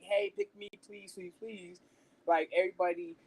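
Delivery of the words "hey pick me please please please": lightly mocking a begging pick-me voice. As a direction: tease it like someone begging to be picked. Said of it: "I wasn't a pick me".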